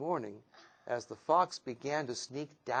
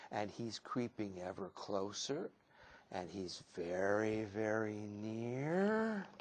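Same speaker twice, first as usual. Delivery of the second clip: much softer, lower, slowing to a creep; hushed suspense; 4 semitones lower, 7 dB quieter than usual. A narrator's reading. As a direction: draw it out softly as the danger creeps close. Fox creeping near the ducklings.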